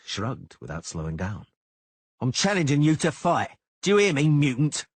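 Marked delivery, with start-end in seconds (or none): 2.2-4.9 higher, louder shouted challenge. A narrator's reading. Cicada shouting a challenge at Geralt, higher and louder. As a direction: yell the insult in his face. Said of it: "Cicada shouted".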